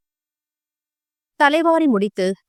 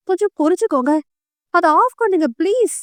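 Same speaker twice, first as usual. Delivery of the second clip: pleading; 6 semitones higher than usual. Acted. Wife pleading with husband.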